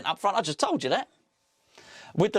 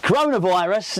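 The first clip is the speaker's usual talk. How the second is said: loud mock question; 4 semitones higher, 7 dB louder than usual.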